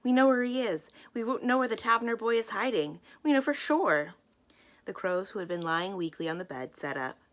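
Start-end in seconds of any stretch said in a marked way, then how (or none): 0.0-4.1 high, loud, tumbling over each other; breathless excited sisters; excited girls. A narrator's reading. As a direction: burst in with big news. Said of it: "came excitedly into the room".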